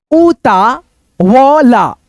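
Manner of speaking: उतावला drawn out very slowly; slow syllable demo. Shown as a demonstration. Teacher sounding out उतावला for students, slowly. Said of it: "फिर यहां पे देखो".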